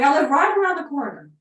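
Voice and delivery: high shouted yeah down the phone; deaf old man screaming. Retold or imitated. Deaf old owner answering his phone, shouting. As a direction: yell it like a deaf old man who can't hear himself. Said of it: "He was screaming".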